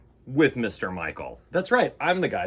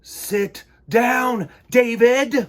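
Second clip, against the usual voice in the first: loud, scolding teacher voice; stern teacher; 11 semitones higher, 9 dB louder, much slower than usual. A narrator's reading. Teacher scolding David in the hall, loud and stern. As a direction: scold him like a strict teacher.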